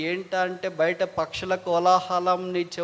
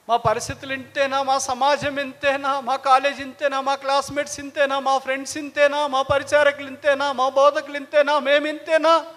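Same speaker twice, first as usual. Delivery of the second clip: high lament building to loud cries; impassioned pleading; 8 semitones higher, 6 dB louder than usual. Acted. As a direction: pile up each plea higher and louder. Acts out mounting complaint to God.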